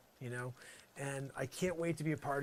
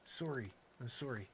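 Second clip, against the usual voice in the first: Canadian apology; 2 semitones lower, 6 dB quieter than usual. Pretend. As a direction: apologise with a light Canadian "sore-ee". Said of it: "all of a sudden I'm Canadian".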